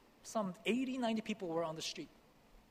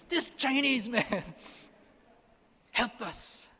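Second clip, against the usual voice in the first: high, loud, slow protest; rally the crowd, shouting in defense; 7 semitones higher, 5 dB louder, much slower than usual. Retold or imitated. Villagers shouting at the sheikh in defense, loud high protest. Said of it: "the people in the back started shouting".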